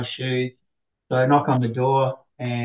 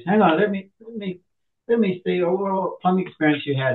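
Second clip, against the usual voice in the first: higher, officious interviewer; lightly voiced boss checking his form; a hint of a brisk boss; interviewing boss; 6 semitones higher than usual.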